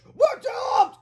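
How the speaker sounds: extremely high-pitched wail; terrified scream. A narrator's reading. Boy chased by monster, screaming in terror. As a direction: shriek it in cartoon despair. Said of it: "screamed Hale".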